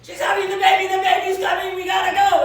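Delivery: loud, high panicked shouting; screaming. Retold or imitated. Father to the border guard, faking an emergency, loud panicked shout. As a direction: yell it like the baby is coming now. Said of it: "my father started screaming".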